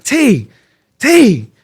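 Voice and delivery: loud, high call across a crowd. Retold or imitated. Calling to his brother from the stretcher, loud and high. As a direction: call his name to get his attention.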